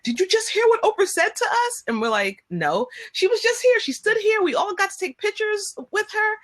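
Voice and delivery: high, breathless retelling; excited disbelief. Retold or imitated. Chanel telling them what they missed, high excited voice. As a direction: gush in disbelief. Friend reports missing Oprah.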